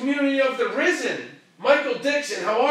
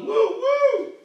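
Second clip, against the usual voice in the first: woo woo cheer; 12 semitones higher, 4 dB louder than usual. Pretend. Cheering himself up, oomph.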